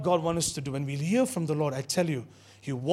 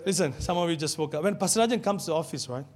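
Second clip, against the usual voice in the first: usual speaking voice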